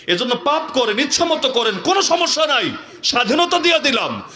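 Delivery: high-pitched, mocking go-ahead; high rhetorical taunt; sarcastic permission. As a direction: grant licence to sin with biting irony. Sarcastic permission to sin freely.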